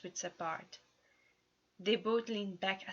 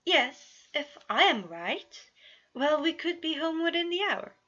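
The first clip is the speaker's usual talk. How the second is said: nervous; 9 semitones higher, 8 dB louder than usual. Acted. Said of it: "Roman was terrified".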